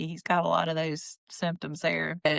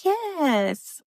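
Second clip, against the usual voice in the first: high chirpy yes; 10 semitones higher, 3 dB louder than usual. Retold or imitated. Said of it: "she's like".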